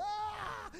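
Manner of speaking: high-pitched imitated child's shriek; mock scream of delighted fright; kids' startled scream. Kids scream when dad yells.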